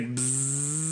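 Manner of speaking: slow, stretched buzzing zip noise; lightly voiced zzz of a zipper; zipper sound. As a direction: mimic a tent zipper opening slowly. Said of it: "the sound effects are off".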